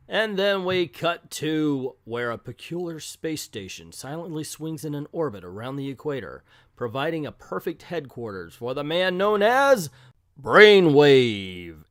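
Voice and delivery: grand narrator, swelling into a loud reveal; dramatic comic narration. Acted. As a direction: build to a booming villain name.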